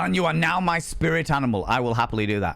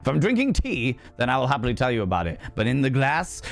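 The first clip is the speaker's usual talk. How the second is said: ordinary talk, nothing marked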